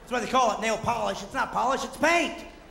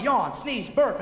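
usual speaking voice

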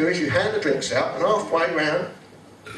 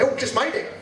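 high breezy chirp; cheerfully grab the last mug; light mock chirp; chirpy colleague; 7 semitones higher than usual. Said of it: "run alongside you and say".

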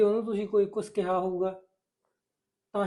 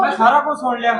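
louder, higher angry accusation; angry husband; 4 semitones higher, 9 dB louder than usual. Acted. Husband tells his wife off for the slap, angry and loud. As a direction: tell her off, furious.

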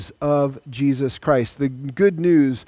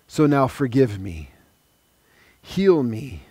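prayer. Acted. Leads a sinner's prayer.

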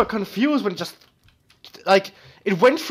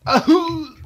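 high, loud "ahoo" wail falling off; imitated death cry; 12 semitones higher, 7 dB louder than usual. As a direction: mimic a game death sound, high and loud. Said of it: "The fucking death sound".

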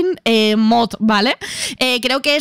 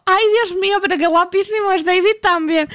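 put-on high, gushing infatuated girl; swooning Rose; 6 semitones higher than usual. Rose gushing about David, swooning high. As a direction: swoon over the handsome guy.

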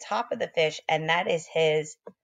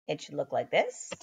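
ordinary delivery

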